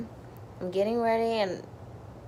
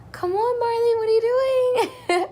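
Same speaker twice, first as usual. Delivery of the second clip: high pleading cry; 7 dB louder than usual. Retold or imitated. New mother urging her baby out during labor, high straining cry. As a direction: urge the baby out, voice breaking high.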